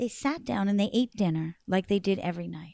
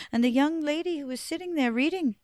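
ordinary delivery

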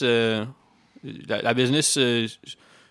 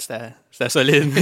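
impressed; 2 semitones higher, 6 dB louder than usual. Real, not acted. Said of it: "pour m'en remettre".

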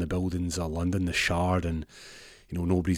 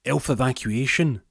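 elf voice; 5 semitones higher than usual. Retold or imitated. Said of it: "And goes".

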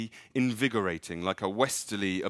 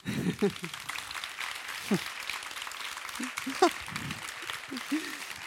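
breathy laugh. Real, not acted. Reader laughs after story.

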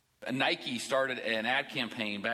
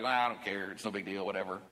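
low, mumbled don't-care voice; mock the couldn't-care-less attitude; lazy, bored indifference; indifferent shrug; 6 semitones lower than usual. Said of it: "like a bump on a log".

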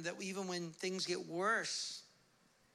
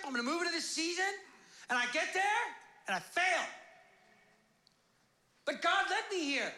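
raised, emphatic pulpit delivery; forceful preaching; 8 semitones higher, 5 dB louder than usual. Real, not acted. Preacher voices a believer's bold step, impassioned. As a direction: drive each phrase out to the congregation.

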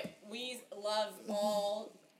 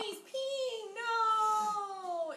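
high-pitched wail of dismay; alarmed, high outcry; dismayed cry; 12 semitones higher, 5 dB louder, much slower than usual. Real, not acted. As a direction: cry out, drawing out a loud no. Dog has accident mid-recording.

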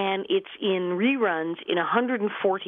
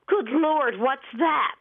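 mock surprise; 6 semitones higher, much slower than usual. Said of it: "and they said".

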